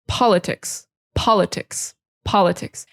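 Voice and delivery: politics repeated, first syllable stressed; politics, POL-i-tics. Shown as a demonstration. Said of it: "emphasis is on the first syllable".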